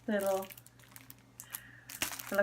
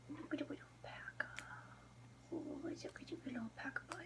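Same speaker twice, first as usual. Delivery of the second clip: fast, much softer whisper; excited whisper; 24 dB quieter, much faster than usual. Real, not acted. Vlogger whispering fast and soft over a tiny alpaca. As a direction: whisper as if not to wake it.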